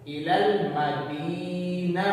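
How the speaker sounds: इलल मदीना chanted slowly, long vowel stretched; stretched madd vowels. Shown as a demonstration. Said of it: "इसको भी लंबा करेंगे". (इलल मदीना में मद्द लंबा)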